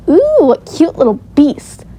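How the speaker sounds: high, loud coo of delight; gushing over children. A narrator's reading. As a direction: fuss over the little ones. Floyd gushes over the children.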